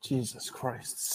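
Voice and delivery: breathed under the breath, unvoiced; muttered exasperated whisper to himself. Real, not acted. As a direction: sigh it out in disbelief. Exasperated at slow typing.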